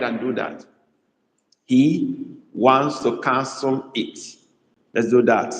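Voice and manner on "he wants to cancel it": slow dictation. Shown as a demonstration. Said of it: "I ask you to write that".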